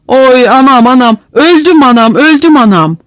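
loud, high wail; wailing in pain. A narrator's reading. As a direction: moan and cry out under a beating. Beaten prisoner wails in pain.

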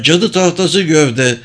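high-pitched sighting report; calling out. A narrator's reading. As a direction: call the sighting to the captain. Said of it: "diye seslendi İdrayana".

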